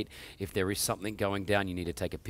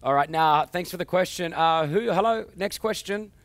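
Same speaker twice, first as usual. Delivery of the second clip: TV host hype; 10 semitones higher, 9 dB louder than usual. Acted. Host calls the next caller.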